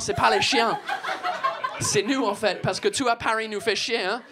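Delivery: French with a put-on English accent. Comedian riffing on swearing in an English accent. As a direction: keep the Brit accent going. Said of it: "cet accent de merde".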